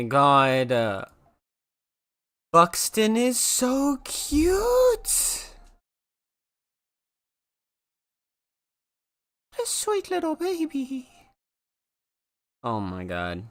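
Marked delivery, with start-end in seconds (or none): 2.5-11.1 high, slow cooing at a puppy; high drawn-out puppy coo. Real, not acted.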